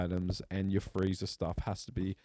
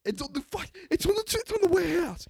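very high-pitched, worked-up voice; mock exasperated rant; 6 dB louder than usual. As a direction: play a worker at the end of his rope. Acted worker ranting at customer.

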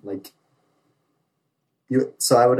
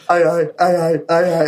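panicked recruit; 6 semitones higher, 5 dB louder than usual. Retold or imitated. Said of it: "he was like".

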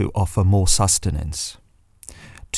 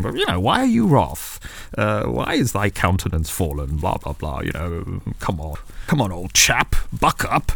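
posh Lord. Acted. The Lord brushing off sulking Cain, posh chummy voice. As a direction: play God as a chummy old-boy toff.

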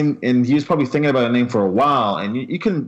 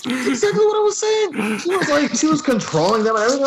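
excited high agreement; 11 semitones higher than usual. Real, not acted.

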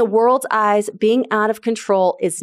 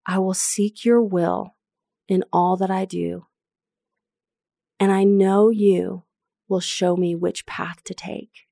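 spoken as a prayer. Shown as a demonstration. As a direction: pray the verse gently, addressing God. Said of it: "I'm gonna say it as a prayer".

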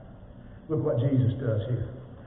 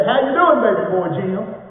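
bright, much higher and louder, beaming at a big grown man; doctor's cheerful greeting; 7 semitones higher, 7 dB louder than usual. Retold or imitated. Preacher imitating his childhood doctor greeting him warmly. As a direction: call out like the old family doctor greeting a child. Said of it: "He'd say".

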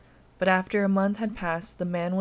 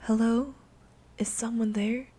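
put-on soft, higher child's call into the dark; timid little girl; 4 semitones higher than usual. A narrator's reading. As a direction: ask gently like a hopeful child. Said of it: "Anna asked softly".

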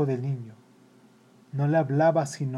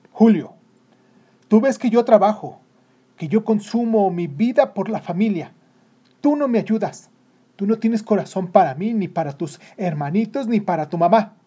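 loud, raised voice of a father scolding; stern, wounded telling-off; harsh reproach; 7 semitones higher, 9 dB louder than usual. A narrator's reading. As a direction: berate your son, hammering each accusation. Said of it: "las palabras más fuertes".

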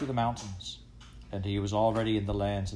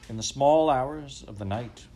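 ordinary delivery, nothing marked

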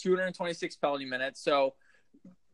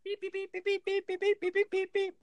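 Morse code beeps. Pretend. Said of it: "Morse code would be better".

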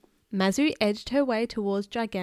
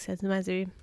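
plain reading voice

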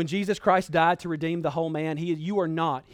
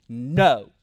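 "No" punched out loud and lower; emphatic, dramatic no; 5 semitones lower, 5 dB louder than usual. Acted. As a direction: land a heavy, dramatic "No". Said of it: "we might emphatically and dramatically say".

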